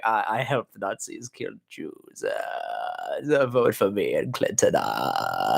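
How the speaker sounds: put-on slow, low, soft old-man voice; hammed-up slow mumbling impression; George Soros. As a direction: drag it out low and quiet. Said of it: "Soros is gonna be on C N N going".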